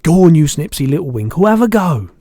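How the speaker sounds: loud, high, brisk giant; urging BFG. A narrator's reading. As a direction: egg on the listener, rising in pitch. Giant urges girl to taste.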